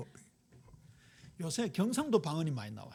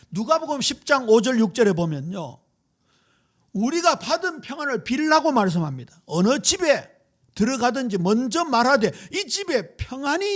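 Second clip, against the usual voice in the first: loud, ringing sermon proclamation, higher and louder; preaching at full force; 4 semitones higher, 8 dB louder than usual. Acted. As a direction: proclaim it to the whole congregation. 설교를 힘차게 선포함. (힘찬 설교 선포)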